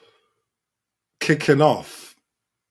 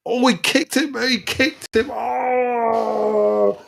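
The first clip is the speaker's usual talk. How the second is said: much higher pitched, crying out in shock; shocked outcry; 11 semitones higher, much slower than usual. Real, not acted. Fan watching the replay of the stamp, shocked outcry. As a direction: gasp it out as you see the kick.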